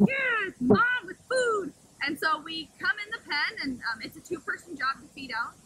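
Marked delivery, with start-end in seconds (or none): 0.1-1.7 high, slow, loud cartoon wolf cheer; eager wolves voiced. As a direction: voice the whole pack begging for food. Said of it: "everybody was like".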